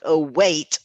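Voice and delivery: remembering with a jolt; mock alarm at a forgotten promise; retold sudden realization. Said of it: "I was like".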